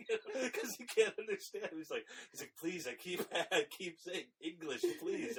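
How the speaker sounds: high, giggly retelling; laughing while talking. Real, not acted. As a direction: crack up laughing through the words. Laughing while retelling funny scene.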